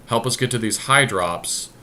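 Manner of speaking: usual speaking voice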